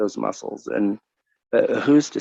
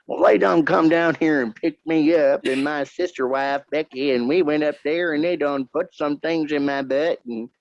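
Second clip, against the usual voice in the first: hillbilly voice; 5 semitones higher than usual. Pretend. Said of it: "I went from hillbilly".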